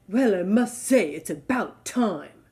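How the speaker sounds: slow, snooty giraffe voice; snooty snort. Acted. Freed giraffe to the elephant, slow and snooty. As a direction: drawl it down his nose, ungrateful. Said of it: "snorted the snooty giraffe".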